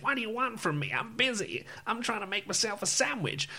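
high, soft put-on receptionist voice; receptionist character accent. Presenter acting out the game's receptionist, put-on accent. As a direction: imitate a busy receptionist in an accent. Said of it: "That was a great accent".

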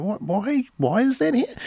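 high-pitched, incredulous questioning; mock bafflement. Host imagining passers-by puzzled by local oddities, mock bafflement.

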